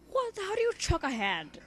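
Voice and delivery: amused disbelief at the wording; mock-puzzled query; incredulous. Real, not acted. Incredulous aside.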